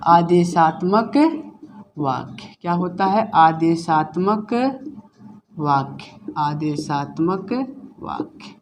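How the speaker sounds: आदेशात्मक drawn out slowly, then वाक्य dropping low and soft; slow drill. Shown as a demonstration. Teacher drilling the Hindi term for the class, slowly fading. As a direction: repeat the term slowly, letting it fade. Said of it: "teacher drilling the term repeatedly".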